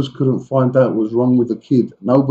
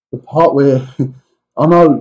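ordinary talk, nothing marked

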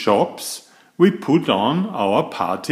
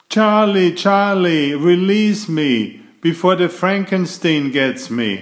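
calling out; 7 semitones higher than usual. Acted. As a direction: cry out for help in the game. Child calling out in game.

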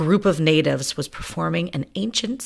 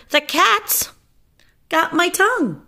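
panicked squeaky mouse; 3 dB louder than usual. Acted. Butcher panicking.

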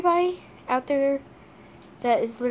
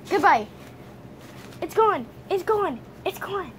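teasing dog; 7 semitones higher than usual. Real, not acted. Owner teasing her dog the toy is gone, high sing-song.

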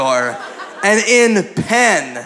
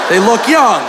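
higher, emphatic protest; insistent; 4 semitones higher, 4 dB louder than usual. Acted. Comedian justifies his remark to the audience, insistent. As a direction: defend yourself with a rising-then-falling push.

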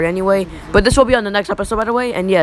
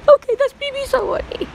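high squeaky voice. Pretend.

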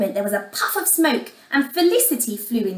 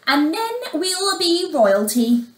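haughty, pitch lifted; proudly; 5 semitones higher than usual. A narrator's reading. Stepmother boasts to the messenger, proud. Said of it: "she said proudly".

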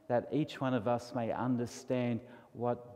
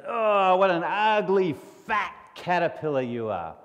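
higher, louder sneering bug voice; mean beetle; 5 semitones higher, 5 dB louder than usual. Acted. Beetle mocking the fat caterpillar, sneering. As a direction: taunt him like a nasty little beetle.

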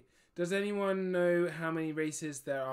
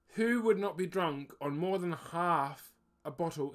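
ordinary delivery

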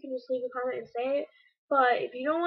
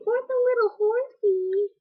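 much higher and louder, a strange sing-song; weird high voice; 7 semitones higher, 7 dB louder than usual. Retold or imitated. Imitating her own weird voice from the recording, high and loud. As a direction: squeak it out oddly, like something childish. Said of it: "being all weird".

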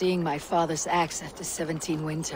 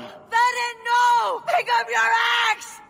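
screaming out in anguish, much higher and louder; desperate shout; 7 dB louder, slower than usual. Acted. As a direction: yell across the battlefield to stop him. Desperate battle cry to ally.

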